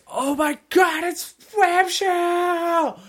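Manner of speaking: very high, drawn-out mock squeal; a mother losing it over a toy, slowly; hammed-up mom gushing. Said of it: "the mom says".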